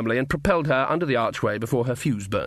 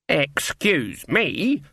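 very slow, higher, loaded with threat; menacing; 4 semitones higher, much slower than usual. A narrator's reading. Granny to the captain, words like charge and kill, menacing. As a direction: make the polite words sound like a battle cry. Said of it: "empowering the words with much the same undertones".

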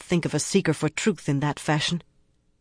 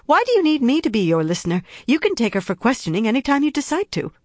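higher, fast, louder panicked outburst; 8 semitones higher, 3 dB louder, faster than usual. Acted. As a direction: blurt it out in a frightened rush. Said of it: "Fear emboldened her, and panicked her".

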